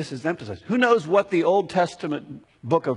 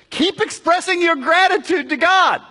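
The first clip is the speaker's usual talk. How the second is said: much higher, louder urging, stress on "God"; urgent paraphrase of Paul; 13 semitones higher, 9 dB louder than usual. Retold or imitated. Preacher voicing Paul to the congregation, loud and high-pitched. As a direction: press the point home, landing hard on "God". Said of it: "So it's like he's saying".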